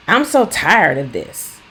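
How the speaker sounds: hammed-up exasperation; mock exasperated yell. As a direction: vent frustration at them. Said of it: "you don't yell at them".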